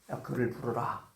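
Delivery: low, slow, soft command; hushed, measured voice of a master; Jesus speaking. Acted. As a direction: quote a calm summons with quiet authority. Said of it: "한마디 하죠".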